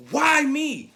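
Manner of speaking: high, loud cry of why me; hurt child protesting unfairness; anguished cry. Retold or imitated. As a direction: throw it at the sky.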